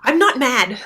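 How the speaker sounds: high, loud protest; a child snapping back that they are not mad; defiant kid denial. Retold or imitated. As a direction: insist like a cross kid who won't admit it. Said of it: "some kids will say".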